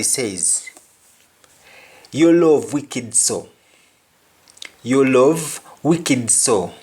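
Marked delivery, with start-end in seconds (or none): 2.1-6.7 patois You love wicked so; slow patois, wicked stressed; Jamaican patois. Shown as a demonstration. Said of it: "Your love is so wonderful".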